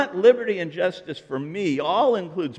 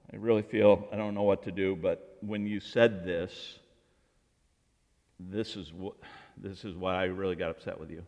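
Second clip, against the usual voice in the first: quiet, lower hurt confession; soft confiding; 8 semitones lower, 5 dB quieter than usual. Retold or imitated. Speaker telling Paul his hurt, soft and hesitant.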